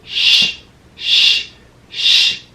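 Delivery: whispered Sh held apart; sounding out Sh for children; stretched sh sound. Shown as a demonstration. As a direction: hiss the Sh before sheep. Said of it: "Can you say sheep?".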